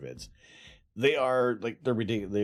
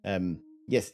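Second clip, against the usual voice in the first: normal talking voice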